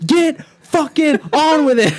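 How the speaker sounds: high, loud burst of impatience; exasperated yell. Acted. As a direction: yell it like you can't wait any longer. Acted impatience at slow videos.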